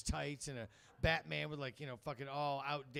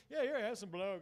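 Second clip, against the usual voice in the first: raised, louder put-on anchorman voice; hammed-up offer in a pompous character voice; Ron Burgundy; 6 semitones higher, 5 dB louder than usual. Coked-up comic offering some to people, mock anchorman voice. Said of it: "I was like Ron Burgundy".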